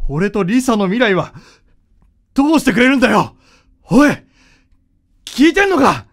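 enraged son demands an answer; angry shouting. Acted. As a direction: yell in outrage at him. Son furious at father's confession.

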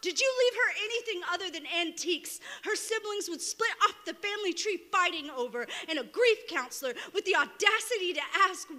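high-pitched, urgent accusing verse; impassioned slam poetry. Acted. Poet demanding answers from a silent dead mother, impassioned accusation. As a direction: fire the questions as accusations, pitch held high.

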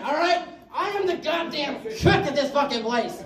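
normal talking voice

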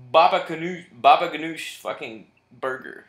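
excited pitch. Real, not acted. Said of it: "Call it that".

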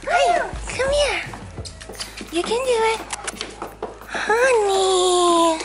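high, drawn-out puppy-calling voice; excited coaxing. Real, not acted. Owner coaxing her puppy to come, high cooing. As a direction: coo sweetly and high to lure a shy animal.

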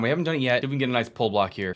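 normal talking voice

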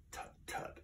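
breathy whispered tut tut. Acted. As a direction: brush it off in a whisper. Maisie dismisses his objection.

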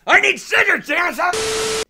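loud high shout to another room; yelling across the room. Real, not acted. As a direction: yell for Dennis from far off. Shouting for scissors to Dennis.

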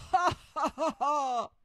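high, loud mock laughter; ha-ha it out boisterously; robber laughing. Robbers laughing at the joke, loud staged laughter. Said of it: "lachten die Räuber".

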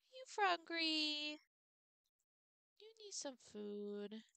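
slow, drawn-out coo for the cat; slow pet baby talk. Acted. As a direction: stretch "frungry" out slowly like soothing a kitten.

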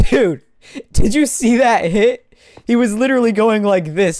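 high, loud incredulous rant; excited rant. Real, not acted. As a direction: loudly demand that they saw it. Ranting about an unfair hit.

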